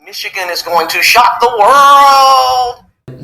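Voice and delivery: loud, high call stretched out on the last word; triumphant announcer shout. Acted. Host crowing over his Michigan prediction, triumphant drawn-out shout. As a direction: build to a long, belted "world".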